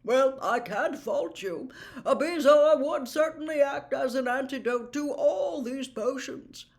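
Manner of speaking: put-on jovial, booming old professor; plummy teacher inspecting the class's work; Slughorn's voice. Slughorn to the class, checking potions, jovial booming.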